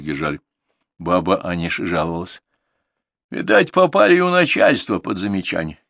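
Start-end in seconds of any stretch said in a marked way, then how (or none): none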